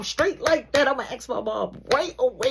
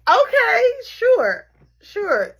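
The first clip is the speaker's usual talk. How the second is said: loud, high mock okay; sarcastic agreement; 12 semitones higher, 8 dB louder, much slower than usual. Sarcastic okay to the mom's invite. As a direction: drag out a fake yes.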